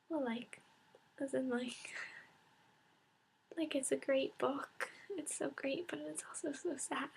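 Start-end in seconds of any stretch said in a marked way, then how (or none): none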